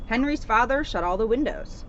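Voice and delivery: usual speaking voice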